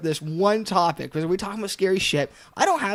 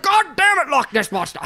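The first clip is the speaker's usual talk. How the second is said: loud, high cartoon outburst; hammed-up exasperated cursing; South Park bit; 6 semitones higher, 4 dB louder than usual. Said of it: "immediately I went to South Park".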